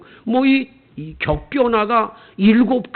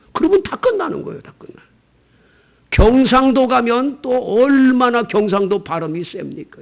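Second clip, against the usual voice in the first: ordinary delivery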